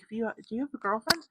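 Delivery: put-on girl's voice. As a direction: pitch up, rush the question out. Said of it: "she was like".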